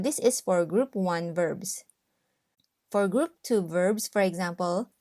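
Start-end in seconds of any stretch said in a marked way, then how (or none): none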